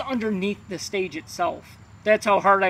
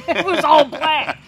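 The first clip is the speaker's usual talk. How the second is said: laughing through the words, slow and high; real laughter; 7 semitones higher, 4 dB louder, slower than usual. Real, not acted. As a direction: let the laugh stretch the punchline. Laughing at own ghost hunt story.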